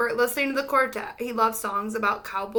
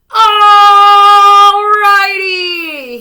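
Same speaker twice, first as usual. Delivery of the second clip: huge long bellow, higher and very loud; 8 semitones higher, 16 dB louder, much slower than usual. A narrator's reading. Holler bellowing at the tornado, huge bellow. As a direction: let it roll out and shake the land. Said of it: "he bellowed so loud".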